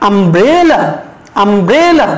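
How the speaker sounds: "umbrella" with a long, stressed middle syllable; stress on BRE. Shown as a demonstration. Teacher leads students in saying "umbrella", middle syllable stressed. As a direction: swell on the middle of "umbrella", then fall away. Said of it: "এখানে আমরা একটু জোর দিয়ে বলবো".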